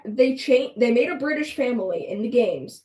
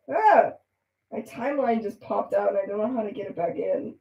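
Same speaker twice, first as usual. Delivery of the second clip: ordinary delivery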